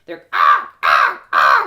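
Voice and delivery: low, loud croaking caws; crow caws. Retold or imitated. Narrator imitates crows over the ramparts, croaking caws. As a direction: caw like a crow.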